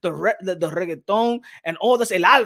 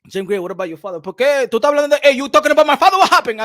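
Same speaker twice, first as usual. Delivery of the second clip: mock Dominican fighter; 5 semitones higher, 6 dB louder, faster than usual. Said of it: "I would have been like".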